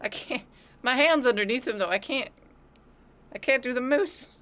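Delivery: high, strained squeak under the cat; squeaky high voice. Real, not acted. Pinned under her cat, squeaky high complaint. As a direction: pipe it up like a cartoon.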